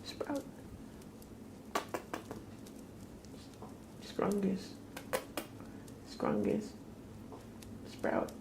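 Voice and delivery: small, high soft call; cat-calling. Real, not acted. Owner calling the cat by nicknames, high and soft. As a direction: call softly in a high pet voice.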